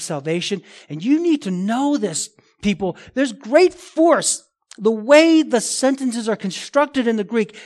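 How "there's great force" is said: GREAT FORCE high and drawn out; slow down and lean hard on "great force"; forceful emphasis. Real, not acted. Preacher stresses the force of verse.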